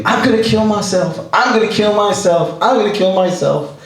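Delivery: high-pitched mock scream of a desperate man; retold screaming. Supermarket stranger threatens himself, a high mock yell. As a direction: pitch it up like a man losing it in a store. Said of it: "he starts screaming".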